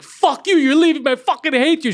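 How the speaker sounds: high, loud screamed outburst; screaming mother. Retold or imitated. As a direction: yell it like a hysterical mom fight. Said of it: "And then they start screaming".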